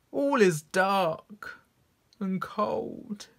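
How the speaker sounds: high, drawn-out theatrical lament; sad voice. Shown as a demonstration. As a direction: wail it sorrowfully, fading away at the end. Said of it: "read it in a sad voice".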